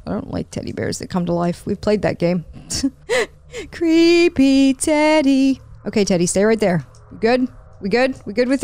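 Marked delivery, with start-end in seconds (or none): 3.1-5.6 high, slow, stretched-out singsong; teasing singsong. Pretend. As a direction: chant it slowly at a creepy toy. Teases creepy teddy bear.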